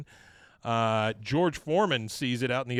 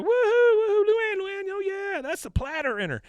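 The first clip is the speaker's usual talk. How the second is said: very high, loud squealing whoops; wild whooping; 6 dB louder than usual. Retold or imitated. Peggy cheering Luann from the crowd, high squealing whoops. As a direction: squeal and hoot for your friend.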